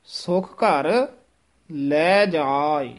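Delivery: ਸੁਖ ਘਰ then ਲੈ ਜਾਏ, slowly and apart; slow deliberate ਸੁਖ ਘਰ, ਲੈ ਜਾਏ; separated words. Shown as a demonstration. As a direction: pause between ਘਰ and ਲੈ ਜਾਏ, never run together. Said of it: "ਘਰ ਲੈ ਜਾਏ ਨਹੀਂ ਕਹਿ ਦੇਣਾ ਹੈ".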